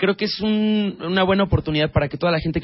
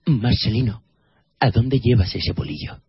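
Marcelino movie voice; 6 semitones lower than usual. Pretend. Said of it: "Cuando dicen".

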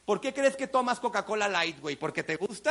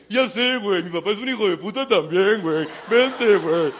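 bro friend; 2 semitones lower, 7 dB louder than usual. Retold or imitated. Friend agreeing and inviting him out, loud buddy voice. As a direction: answer like a careless pal.

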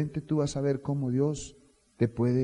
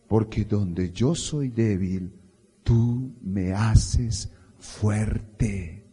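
slow prayer; 4 dB louder, much slower than usual. Shown as a demonstration. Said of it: "quiero que levante su mano y diga".

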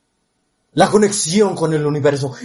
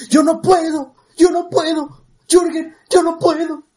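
much higher, pleading "yo no puedo"; mock a helpless whiner, pitched way up; whiny quote; 13 semitones higher than usual. Coach mimics clients whining they can't. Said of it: "la gente es decidiosa a decir".

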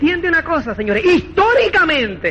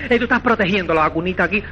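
hurried soothing quote; 4 semitones lower, faster than usual. Retold or imitated. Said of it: "A decirle".

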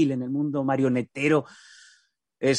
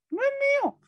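agudísima, chillona de muñeco; vocecita de pájaro; much faster than usual. Acted. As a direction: pon voz de pájaro de ventrílocuo. Voz del pájaro, muñeco. (vocecita aguda de pájaro de ventrílocuo)

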